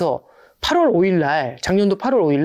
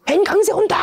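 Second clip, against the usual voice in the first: mock excited cry. Investor spotting the yen rise, high excited cry. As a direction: shout it like a rushing investor spotting the move. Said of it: "그럼 무슨 느낌이 들죠?".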